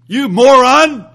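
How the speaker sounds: loud, high, slow sneering "You moron!"; contemptuous insult. Retold or imitated. Preacher voicing a man insulting his brother, loud scorn.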